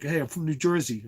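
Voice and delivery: mock Jersey accent, pitched a bit up. Shown as a demonstration. Imitating how Union County people talk, mock Jersey accent. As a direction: put on a hammed-up New Jersey accent. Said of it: "they talk like this".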